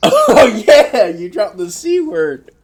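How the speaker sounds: high, loud, drawn-out amused agreement; real laughing outburst. Real, not acted. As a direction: crack up loudly as you concede it. Laughing at corrected quote.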